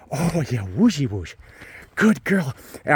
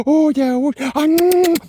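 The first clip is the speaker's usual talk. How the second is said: squealing excitement; 13 semitones higher, 7 dB louder, slower than usual. Real, not acted. Excited greeting of dog.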